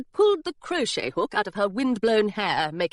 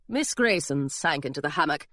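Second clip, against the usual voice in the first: ordinary delivery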